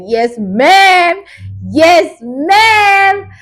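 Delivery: high, drawn-out approving shout; cheering audience. Retold or imitated. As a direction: holler your approval from the cinema seats. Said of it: "Everybody was like".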